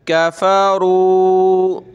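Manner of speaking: कफ़रू with a light काफ and a thick रा, slowly; heavy ra, thin kaf. Shown as a demonstration. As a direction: draw out कफ़रू, the रा full. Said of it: "रा को colourful किया हुआ है कि मोटा करना है". (कफ़रू में रा मोटा, काफ पतला)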